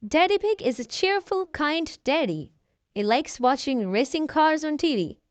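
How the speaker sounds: plain reading voice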